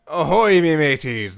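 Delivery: hearty pirate greeting. Pretend. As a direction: call out like a jolly pirate. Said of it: "I'm not sorry for the pirate voice".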